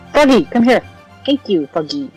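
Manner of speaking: usual speaking voice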